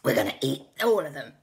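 lightly voiced raptor threat; velociraptor language. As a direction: snarl it like a hungry raptor. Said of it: "in velociraptor language".

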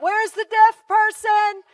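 high, loud, slow calling out; yelling out. Retold or imitated. Preacher to the crowd, yelling for the deaf person. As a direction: call out over a crowd. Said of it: "I start yelling out".

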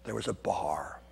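breathy conspiratorial whisper; hushed joking whisper before a laugh; whispered aside. Real, not acted. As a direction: let them in on a little secret. Conspiratorial aside about Vatican bar.

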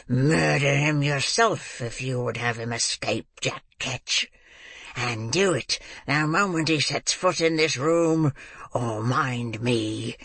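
put-on slow, menacing old man, swooping in pitch; exasperated. A narrator's reading. Old man threatening the girl over the boy, exasperated menace. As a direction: threaten her, dragging and leaning on words. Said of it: "exasperated beyond all bounds".

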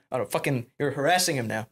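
usual speaking voice

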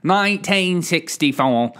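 mock English accent on the date; playful English accent. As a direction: say the year like a proper Brit. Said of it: "I do an English accent every time for a date".

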